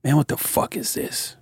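soft whispered what the fuck; hushed, disbelieving mutter; whispered disbelief. Retold or imitated. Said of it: "I was like".

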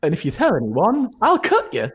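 squeaky cheerful threat; menacing high voice. Acted. NPC threatens players.

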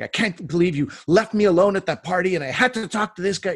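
mock indignant rant; retold complaint. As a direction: act out an aggrieved partner on the drive home. Partner's complaint voiced in a story.

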